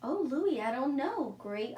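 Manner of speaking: normal talking voice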